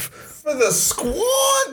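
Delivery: high, slow mock wail; mock crying. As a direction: whimper it like a joke sob.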